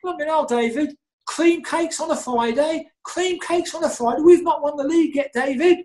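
very high, incredulous mock voice; Tony Scholes impression. Chairman scolding David over cakes, very high mock outrage. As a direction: shriek it up high in disbelief. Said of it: "He'd go".

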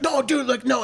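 high-pitched, hurried protest; mock panicked pleading. Friend protesting to the furious neighbor, high mock pleading. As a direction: imitate a friend trying to calm things down.

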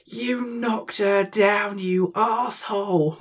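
high, drawn-out indignant cry; mock outrage. Friend scolding whoever knocked her down, mock outrage. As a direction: wail the accusation slowly, high-pitched. Acted outburst at someone in a story.

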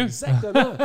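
blurting it out high and loud through a laugh; laughing agreement; high laughing exclamation. Real, not acted. Laughing agreement at own joke.

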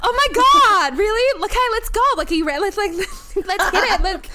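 high, loud burst of mock excitement; hammed-up delighted disbelief. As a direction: act thrilled, pitch shooting up. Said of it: "be [name] hitting on [name]".

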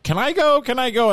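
put-on high, eager pleading child voice; begging kids. Kids begging to come along.

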